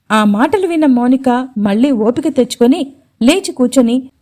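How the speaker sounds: ordinary delivery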